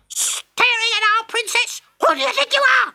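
very high squeaky mocking voice; jack-in-the-box voice. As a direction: jeer and threaten like a spiteful toy. Jack-in-the-box taunting soldier.

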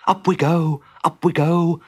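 high little working chant; muttering to himself. A narrator's reading. Mole digging upward with his paws, muttering.

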